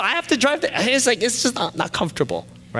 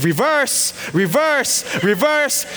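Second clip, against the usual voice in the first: high, loud barked order; hammed-up backseat dad yelling commands; imitating dad; 12 semitones higher, 5 dB louder, slower than usual. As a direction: shout it insistently at the driver. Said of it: "My dad's like".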